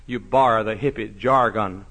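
usual speaking voice

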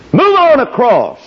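climbs to a high ringing shout on the last words; triumphant call. Acted. Moses crowing to the Israelites after parting the water, high triumphant shout. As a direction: crow it out to the crowd.

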